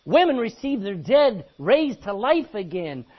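loud pulpit proclamation; ringing Bible declamation; triumphant scripture reading. Real, not acted. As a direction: proclaim it as a victory. Triumphant climax of scripture list.

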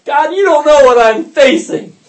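high, loud wail to God; hammed-up cry of self-pity; mock anguished prayer. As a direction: parody a complaining worshipper. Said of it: "that's how we pray".

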